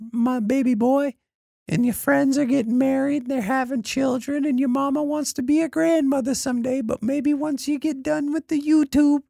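high, pleading mother's voice; mom voice. Retold or imitated.

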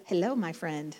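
half-joking impression of a YouTuber's accent; [name]'s terrible accent. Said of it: "It's a terrible accent".

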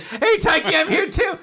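high-pitched imitation of a friend calling out; mock cheery call. Co-host voicing the player turning up to interrupt, mock cheery call. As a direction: butt in brightly, voice pushed way up.

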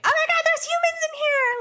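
very high, squeaky cartoon bat voice; squeaky mock bat panic; panicking bats. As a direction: squeal it like a tiny frantic bat.

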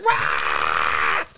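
imitated crowd holler; loud yell. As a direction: yell like you're winning a loudness contest. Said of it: "the loudest person here gets some".

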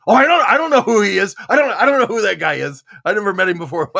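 high, loud defensive protest; grandma denying she knows the handsome man; flustered denial. Retold or imitated. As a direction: deny it too fast.

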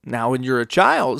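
ordinary talk

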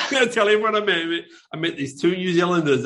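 loud, high-pitched retold boast; mock excitement. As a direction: imitate someone bursting to spread the story. Acts out the American guy's excited boast.

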